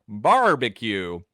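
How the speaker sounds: "Barbecue" in Texan, very strong R; exaggerated Texan with a hard, held R; Texas accent. Shown as a demonstration. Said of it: "you need a really strong R".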